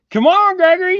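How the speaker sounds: high, loud excited call; loud, high call to Gregory to come in. Real, not acted. Calling son in.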